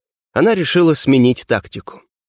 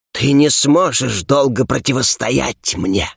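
usual speaking voice

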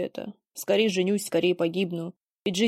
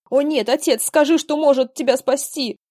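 high, loud desperate cry; panicked pleading; 6 semitones higher, 8 dB louder than usual. Acted. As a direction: beg in panic to save him. Son panics over dying father.

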